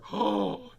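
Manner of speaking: loud sharp gasp; playful dramatic gasp; mock gasp.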